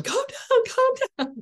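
hammed-up high mock yell; mock yelling parent. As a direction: parody a mom yelling calm down at her kids. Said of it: "Not from you yelling at them".

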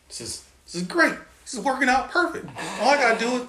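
overjoyed soldier thinking he's got it made; excited. Retold or imitated. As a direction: gush like it's all falling into place. Thrilled at the offer, retold.

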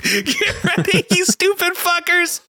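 very high, laughing mock shriek; manic screaming. Streamer threatening audience with endless lore podcast, manic screaming. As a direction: cackle through an unhinged hype speech. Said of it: "I'm sorry for screaming into the mic".

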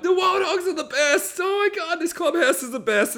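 high, giddy mock fan voice; excited gushing. Friends loving their new clubhouse, hammed-up high excitement. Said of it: "they're so excited".

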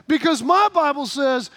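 usual speaking voice

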